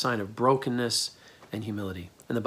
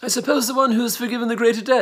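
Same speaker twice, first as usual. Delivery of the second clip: high, fast, louder mock British accent; hammed-up English upper-crust accent, pitched way up; aristocratic British accent; 11 semitones higher, 7 dB louder, faster than usual. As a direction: exaggerate the arrogant English aristocrat. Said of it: "English elite, the aristocracy".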